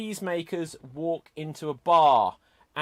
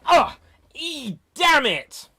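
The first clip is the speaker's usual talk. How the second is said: frustrated outburst; 10 semitones higher, 6 dB louder, slower than usual. Real, not acted. Host fumbles the joke setup.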